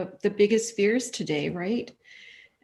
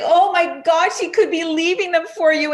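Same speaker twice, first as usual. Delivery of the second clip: high, loud amazed outburst; a gush of amazed wonder; excited exclamation; 9 semitones higher, 9 dB louder than usual. Retold or imitated. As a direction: burst out in awe, almost overwhelmed. Retold goosebump exclamation.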